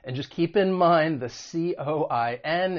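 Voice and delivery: ordinary delivery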